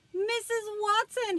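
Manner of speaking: loud high call to his wife; husband calling wife to the door, loud and high. A narrator's reading. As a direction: call her from the front door. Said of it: "he called".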